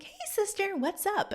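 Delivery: high sing-out hello, imitating a friend; acted-out high-pitched cheery hail; cheery greeting. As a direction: mimic a pal's chirpy greeting.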